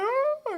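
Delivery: high mock sobbing wail; mock sob wail. Preacher imitating the sobbing pessimist twin, high wail. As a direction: wail like a crying six-year-old. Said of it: "you know, he's sobbing".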